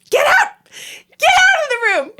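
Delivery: high, loud retold scream; furious yelling. Wife throwing husband out of the room, furious yelling. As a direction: yell it at the top of the voice. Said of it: "yelling at him".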